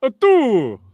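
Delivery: loud counting vampire with staccato laugh; Count Dracula. Acted. Vampire count tallying aloud, hammed-up and loud. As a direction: count grandly, each number ringing out. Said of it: "It's Count Dracula in the middle".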